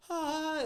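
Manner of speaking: mock scream.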